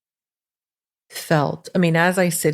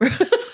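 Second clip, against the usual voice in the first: short high laugh. Real, not acted. Laughs at own remark.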